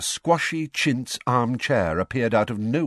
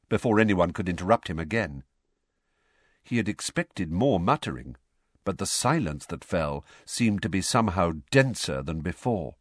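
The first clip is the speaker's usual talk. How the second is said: ordinary delivery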